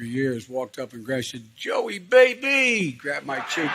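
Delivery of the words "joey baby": slow high hearty call; big drawn-out hello; drawn-out greeting. Retold or imitated. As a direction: stretch the name like a fond old friend. Conductor greeting.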